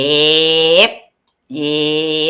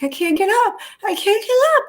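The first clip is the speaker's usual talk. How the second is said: much higher squeaky victim voice; imitating helpless Marge; 4 dB quieter, faster than usual. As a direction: wail it like the trapped victim. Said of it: "And she's like".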